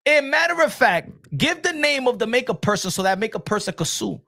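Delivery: normal talking voice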